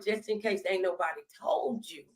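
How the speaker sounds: ordinary talk, nothing marked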